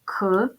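"к" as a bare hard k sound; isolated consonant sound. Shown as a demonstration. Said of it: "Називаємо приголосні звуки".

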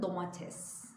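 "domates" with the final -es; standard pronunciation. Shown as a demonstration. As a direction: say "domates" in three syllables. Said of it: "domat da der bazıları".

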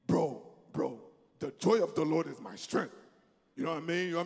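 low, laid-back bro talk; casual bro voice. Acted. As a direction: toss it off like chatting to a buddy.

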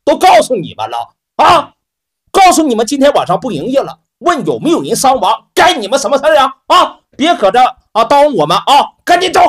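loud, high arrogant scolding; bully the strangers out the door; a cocky manager barking at outsiders. Acted. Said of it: "他你看也挺牛的".

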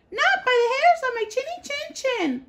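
very high squeaky refusal; little pig refusing the wolf, high and defiant. A narrator's reading. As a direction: squeak back bravely. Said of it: "the little pig replied".

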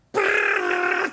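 high, loud imitated insect buzz; buzzing bug noise. Imitating a big bug buzzing inside the lamp shade, loud buzz. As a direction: rattle it out like wings in a lampshade. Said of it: "って言おったんですよ".

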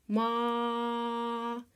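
Ма read as one long drawn-out syllable; blended syllable. Shown as a demonstration. Teacher to class blending м and а into one long syllable. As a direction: glide the м into the а slowly. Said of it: "Літера А".

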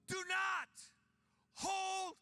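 high, loud, drawn-out cry to God. Retold or imitated. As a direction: cry it out loudly, slowly. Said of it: "he cried out loudly".

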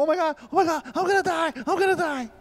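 terrified moviegoer squealing at the screen; panicked viewer. Retold or imitated. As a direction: squeal it like a terrified first-timer. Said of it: "they go".

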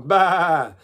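loud sheep baa with vibrato; quivering sheep bleat. Shown as a demonstration. Animal warm-up for vibrato, quivering sheep bleat. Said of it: "making your voice sort of quiver".

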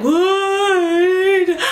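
high loud cry of pain; crying out in labour pain. Retold or imitated. Mother in labour crying out to the midwife, high pained cry. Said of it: "I'm, I'm like, just like".

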